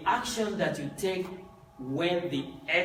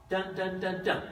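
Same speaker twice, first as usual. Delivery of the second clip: imitating a talking drum, "dun, dun" a bit higher and punchy; 5 semitones higher than usual. Shown as a demonstration. Teacher imitating the talking drum sound. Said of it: "talking drum sound".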